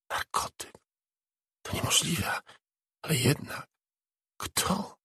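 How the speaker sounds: shocked whisper. A narrator's reading. Angie finding the drug packet, whispering in shock. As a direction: whisper it to yourself in disbelief.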